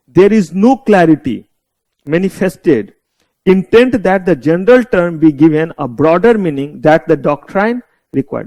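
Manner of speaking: slow, word-by-word reading of the legal text; slow dictation. Shown as a demonstration. Teacher dictating the statute to students, slow and deliberate. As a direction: read it out slowly so they can write it down.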